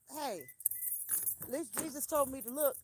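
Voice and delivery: ordinary talk, nothing marked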